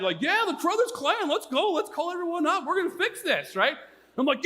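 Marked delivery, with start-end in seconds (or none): 0.2-3.6 high-pitched mock battle cry; rally the clan in hammed-up excitement; angry excitement. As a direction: imitate a riled-up mom, pitched way up. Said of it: "gets my mom angry and excited".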